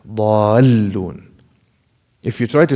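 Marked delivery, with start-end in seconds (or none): 0.1-1.2 "ضالون" with a long "daa" and held double l; doubled lam. Shown as a demonstration. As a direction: press and hold the l in "ضالون" after a long vowel. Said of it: "to be able to pronounce al-lam al-mushaddada".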